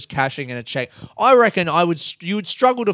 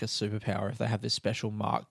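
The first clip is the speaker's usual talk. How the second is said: normal talking voice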